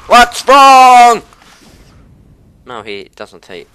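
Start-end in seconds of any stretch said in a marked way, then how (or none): 0.1-1.2 high, loud, drawn-out yell; exasperated yell. Real, not acted. Gamer yells at his teammate, high, loud and long. Repeated question, escalating.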